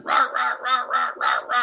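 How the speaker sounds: light dog-bark imitation; imitated dog barking. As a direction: play the neighbour's dog barking at you mid-rosary. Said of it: "there's a dog barking".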